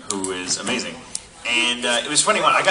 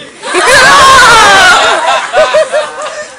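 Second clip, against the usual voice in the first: loud high burst of laughter; shrieking laugh; 18 dB louder than usual. Real, not acted. Teller recalling the star's request, loud high laugh. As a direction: howl with laughter.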